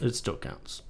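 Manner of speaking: breathy half-whisper. Real, not acted. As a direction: say it under your breath. Whispered repeat of partner.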